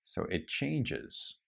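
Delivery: ordinary talk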